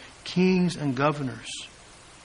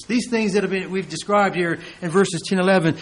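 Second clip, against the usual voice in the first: ordinary delivery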